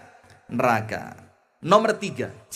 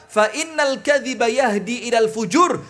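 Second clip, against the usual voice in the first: pembacaan hadis lambat dan berirama; hadith recitation; 4 semitones higher, 4 dB louder than usual. Acted. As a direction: lantunkan hadis dengan khidmat. (ustadz membacakan hadis kepada jamaah, melantun pelan)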